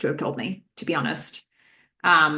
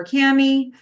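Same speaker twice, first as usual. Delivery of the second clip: yelling her name; 7 semitones higher, 5 dB louder than usual. Acted. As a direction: call Cammy out, high and loud. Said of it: "he's yelling at the guys".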